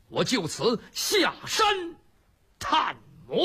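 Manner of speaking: drawn-out, stagey announcement, word by word; slow theatrical declaration; declamatory vow. Acted. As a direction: proclaim the departure slowly, falling at the end. Li Kui's stagey declaration of departure.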